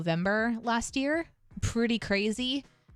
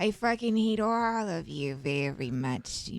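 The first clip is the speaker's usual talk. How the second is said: nasty Bab voice. Shown as a demonstration. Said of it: "she was just nasty".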